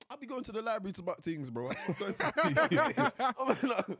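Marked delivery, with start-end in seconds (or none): 1.7-3.9 high and loud through laughter; laughing. Real, not acted. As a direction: laugh while saying it. Laughing at own joke.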